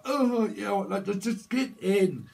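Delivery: high, slow just get in; mock pushy mover. Mover pushing someone through a door, high and coaxing. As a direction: coax them through the door, drawn out.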